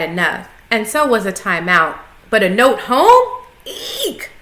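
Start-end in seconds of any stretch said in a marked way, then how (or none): 2.3-4.3 scared squeak. A narrator's reading. Hamster scared by consequences.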